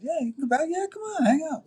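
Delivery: high, casual come-on-in from the studio crew; friendly invitation. Retold or imitated. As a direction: imitate them waving him in cheerfully. Studio people invite him back.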